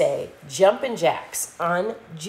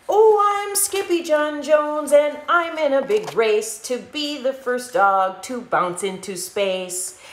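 higher, louder excited boast; excited kitten; 6 semitones higher, 4 dB louder than usual. Acted. Skippy playing rocket dog, excited and higher. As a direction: boast about the space race with glee.

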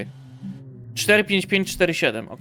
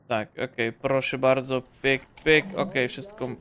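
ordinary talk

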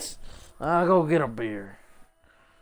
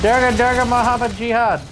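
mock shouting; 8 semitones higher, 6 dB louder than usual. Mock chanting names loudly.

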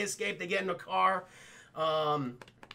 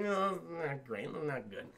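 muttered dismay; 4 semitones lower, 8 dB quieter than usual. Acted. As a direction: let it drop away in mock dread. Commenting in mock dismay.

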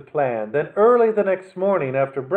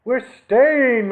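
loud, high shout; 9 semitones higher, 6 dB louder than usual. A narrator's reading. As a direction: cheer it out. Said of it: "Mittens shouted".

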